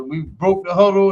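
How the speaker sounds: ordinary talk, nothing marked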